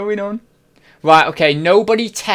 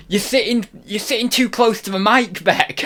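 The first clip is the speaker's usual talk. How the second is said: high-pitched nagging impression; put-on complaining voice, dropping on the name; mock a friend's fussy telling-off; Zahir; 5 semitones higher than usual. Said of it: "and then the other one is".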